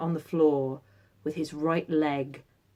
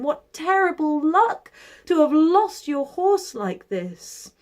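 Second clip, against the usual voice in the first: high, pitying neighbour voice; sympathetic neighbours; 9 semitones higher, 4 dB louder, slower than usual. Acted. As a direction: lament the loss, high. Neighbours pity the old man.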